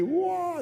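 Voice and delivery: high, held-out whoa; imitated movie whoa. Pastor recalling Kung Fu movie for congregation, imitated high whoa. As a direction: mimic a film sound with a high sustained note.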